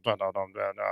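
low, very quiet mumble; muttering aside. Retold or imitated. Two dudes over in the corner, muttering low. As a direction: imitate men muttering privately, low and hushed.

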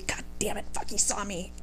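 soft whispered cursing; whispered curse. Retold or imitated. Dodging the suitor at the party, a soft whispered curse. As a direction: curse under your breath. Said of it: "you're just like".

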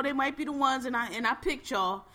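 ordinary delivery

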